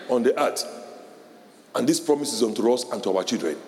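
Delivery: low, measured scripture reading. Acted. Preacher reading Bible promises to congregation, low and measured. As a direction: read the verses solemnly.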